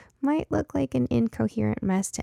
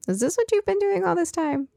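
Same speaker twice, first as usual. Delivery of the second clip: surprised; 10 semitones higher than usual. Acted. Mother surprised at long absence.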